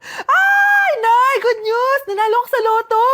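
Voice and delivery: shrieked high and loud; thrilled child shouting to mother; excited shout. Acted. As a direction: burst in yelling the good news. Lotto win, calling out.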